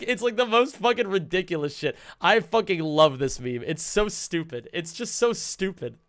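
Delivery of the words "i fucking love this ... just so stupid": usual speaking voice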